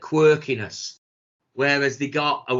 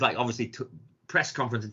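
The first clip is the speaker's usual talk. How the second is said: usual speaking voice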